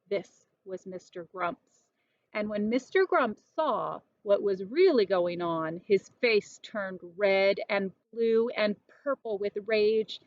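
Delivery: plain reading voice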